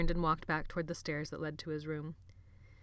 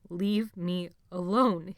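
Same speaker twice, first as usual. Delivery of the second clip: slow, broken, gasped words; gasping plea; 7 dB louder, much slower than usual. A narrator's reading. Jonathan begging Benny, slow and gasped. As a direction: gasp it out. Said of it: "he managed to gasp".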